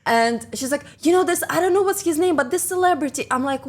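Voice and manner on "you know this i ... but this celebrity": flustered colleague. Retold or imitated. Colleague flustered over celebrity.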